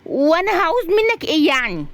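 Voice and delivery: high, sharp-tongued comeback; high female-character protest; indignant retort. Acted. Razan retorts in story quarrel.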